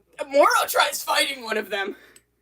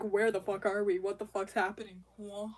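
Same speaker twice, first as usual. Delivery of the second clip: low, softer, still bewildered; calmed down; 7 semitones lower, 9 dB quieter than usual. Retold or imitated. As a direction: drop to a subdued, lost murmur after the panic. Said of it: "at some point they calm down".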